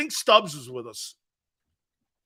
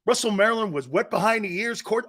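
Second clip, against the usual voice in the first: ordinary talk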